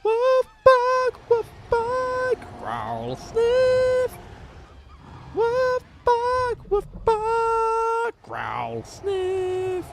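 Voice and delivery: bark, growl and sniff like a cartoon dog; playing a dog. Streamer playfully voicing a dog for chat, high yaps.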